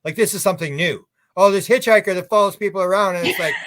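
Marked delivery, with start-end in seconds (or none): 1.4-3.2 mocking. Mocking researchers' discovery.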